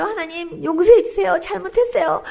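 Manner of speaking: pleading, rising into a high cry on the last 잘못했어요; retold crying repentance. Woman reliving repentance before God, pleading cry. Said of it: "제가 막 이러면서".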